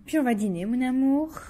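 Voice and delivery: loud cooing to a little one. Real, not acted. Mother speaking to her baby.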